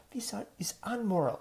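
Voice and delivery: hushed, shocked whisper. Retold or imitated. Scandalized host whispering to the hugging guest. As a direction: breathe it like a scandal.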